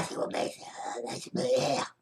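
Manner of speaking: library whisper. Pretend. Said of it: "parlez plus bas".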